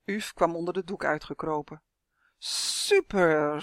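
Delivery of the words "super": buzzing dragonfly. A narrator's reading. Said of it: "zoemde hij".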